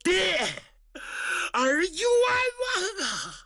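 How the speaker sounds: high sobbing cry of injustice; sobbing plea. Acted. Wronged son cries to his godfather, sobbing high. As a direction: wail out the wrong done to him. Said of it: "眼泪好像珍珠断线一般".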